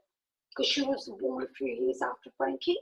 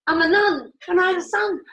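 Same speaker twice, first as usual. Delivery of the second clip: raised, louder incredulous telling; emphatic reveal; 4 semitones higher, 7 dB louder, faster than usual. Retold or imitated. Woman revealing her past life as a nun, emphatic. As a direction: push it out loud and higher.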